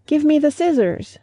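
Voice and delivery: tiny, high crab voice; a little crab's high plea; small crying voice. A narrator's reading. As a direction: squeak it out like a tiny new crab. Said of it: "crying in a very small voice".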